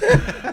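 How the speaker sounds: loud high laugh bursting out; sudden loud laugh; burst of laughter. Real, not acted. Laughing at own story.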